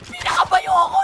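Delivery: excited high yell. Real, not acted. High exclaim while fighting.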